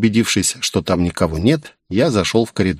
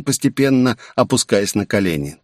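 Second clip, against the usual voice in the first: plain reading voice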